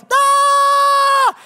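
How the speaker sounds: high, loud startled shriek; sudden shrill yelp of fright; terrified scream. Retold or imitated. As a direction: shriek like a little girl in panic. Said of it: "all three of us go".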